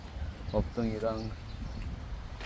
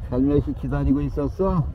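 cooing pet talk; 6 semitones higher, 8 dB louder than usual. Real, not acted. Greeting a waiting stray cat, higher cooing pet talk. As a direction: coo to the shy cat.

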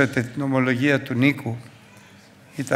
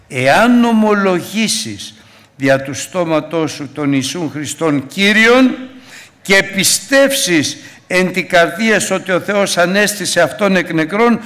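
preaching at full force; 6 semitones higher, 8 dB louder than usual. Acted. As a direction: proclaim the verse to the congregation. Preacher quotes scripture loudly.

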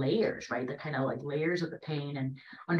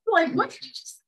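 high, astonished What; stunned disbelief; 3 dB louder, slower than usual. Retold or imitated. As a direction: gasp it out in total disbelief. Teacher stunned by child's maturity.

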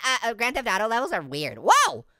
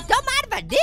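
put-on character voice; 12 semitones higher than usual. Voicing game character.

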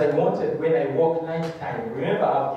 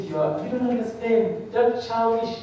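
urgent warning; 7 semitones higher than usual. Retold or imitated. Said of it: "She said".

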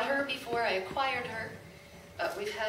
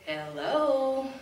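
parrot call; 2 semitones higher than usual. Retold or imitated. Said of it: "she'll say".

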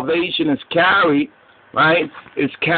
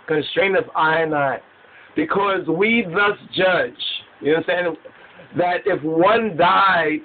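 slow, raised-pitch declaiming with pauses; scripture recitation; 4 semitones higher than usual. Acted. Preacher explaining that one died for all, emphatic recitation. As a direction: preach the verse phrase by phrase.

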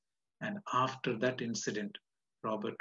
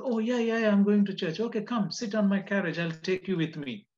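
high, loud eager invitation; woman insisting he come along, eager and high; 9 semitones higher, 6 dB louder than usual. Acted. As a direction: gush with excitement. Woman's reply voiced eagerly.

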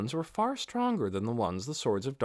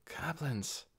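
soft murmur, barely above a breath; 3 semitones higher, 10 dB quieter than usual. A narrator's reading. Ninya spots the goblins, murmuring quietly. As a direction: murmur the word under your breath. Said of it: "Ninya murmured".

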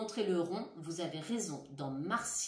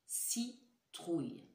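Citrouille very slow and softer; slow soft word; 4 dB quieter, much slower than usual. Shown as a demonstration. Teacher repeats citrouille slowly and softly. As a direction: stretch Citrouille gently, syllable by syllable. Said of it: "Réfléchissez bien".

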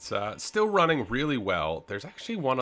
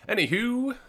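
squeaky voice; 5 dB louder than usual. Acted. Squeaky throwaway word.

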